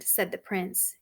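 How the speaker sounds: plain reading voice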